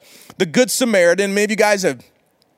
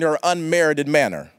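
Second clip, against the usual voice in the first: ordinary talk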